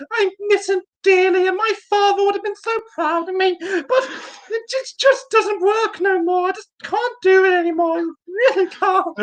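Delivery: very high-pitched, hammed-up crying damsel; Victoria. Podcaster recapping seaweed monsters as Victoria, tearful falsetto parody. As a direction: wail it in a mock falsetto. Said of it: "you're gonna have to sound like".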